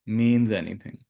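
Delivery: slow, deliberate meditation phrases with long silences; guided meditation. Acted. Teacher giving students lines to repeat in their minds, slow meditative delivery. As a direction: lead them slowly through each phrase, leaving space to repeat it.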